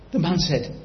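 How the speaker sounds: normal talking voice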